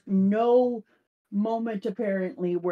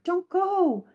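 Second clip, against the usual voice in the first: high pleading cry; pleading crew; 10 semitones higher, 4 dB louder than usual. Retold or imitated. Crew begging the vanishing dragon to stay, high plea. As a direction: beg it not to leave, pitched up. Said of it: "And they're like".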